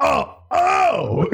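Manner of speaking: high startled oh oh; startled yelp. Retold or imitated. Retelling the doctor's finger with a high startled yelp. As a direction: yelp like something just jabbed you. Acting his reaction to the finger.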